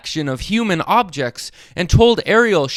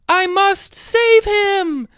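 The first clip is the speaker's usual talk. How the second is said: high, slow desperate cry; slower than usual. A narrator's reading. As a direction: call out urgently to save him. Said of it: "cried Ariel".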